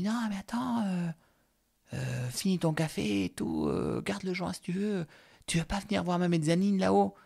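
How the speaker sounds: mock sweet invitation; imitating Momo. Momo insisting he stay.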